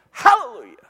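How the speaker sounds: imitated shouted hallelujah. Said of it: "You ever heard somebody go".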